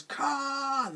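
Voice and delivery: high, drawn-out mock cry without extra volume; a lightly voiced Spock crying out the name; Spock's Khan cry. Spock crying out the enemy's name in the remake. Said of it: "instead it's Spock who goes".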